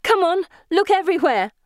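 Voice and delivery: high, frantic shout. A narrator's reading. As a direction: shout urgent orders. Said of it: "I shout".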